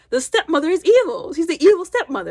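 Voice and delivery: retelling Pip's argument with emphasis; emphatic point. Retold or imitated. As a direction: drive home the word evil.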